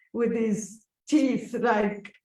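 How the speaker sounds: ordinary talk, nothing marked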